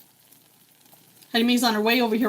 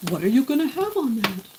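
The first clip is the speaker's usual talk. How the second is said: much higher put-on brain voice; inner voice; 9 semitones higher than usual. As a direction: voice the nagging brain in a squeaky voice. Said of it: "my brain is like".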